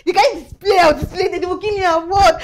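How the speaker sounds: loud, rushed gushing; excited boast. Acted. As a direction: brag loudly, tumbling over the words. Boasting excitedly about the visit.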